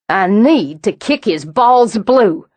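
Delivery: slow, deliberate, seething threat; slow menace. A narrator's reading. Roz furious about Bryce, slow seething threat. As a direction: spit out each word.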